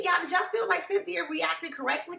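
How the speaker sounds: normal talking voice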